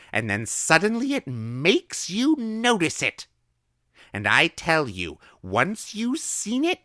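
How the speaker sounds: high, loud, slow gleeful lecture; excited old woman. A narrator's reading. Old woman gleefully warning the boy about small print. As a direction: relish warning the child.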